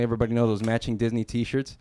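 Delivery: usual speaking voice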